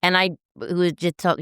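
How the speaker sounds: normal talking voice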